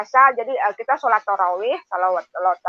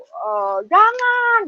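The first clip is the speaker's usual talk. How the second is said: hoped-for plea; 10 semitones higher, much slower than usual. Retold or imitated. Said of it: "Pengen ada yang ngomong begitu".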